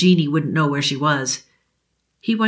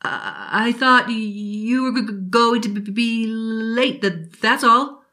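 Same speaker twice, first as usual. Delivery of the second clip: put-on higher, frightened stammering boy; forced stutter; 5 semitones higher, slower than usual. Billy faking his stutter for his drunk father. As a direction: fake the stutter to fool him. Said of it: "force a stutter".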